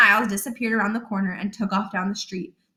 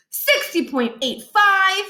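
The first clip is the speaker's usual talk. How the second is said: called-out numbers; 10 semitones higher, slower than usual. Acted. Calling out fake measurements.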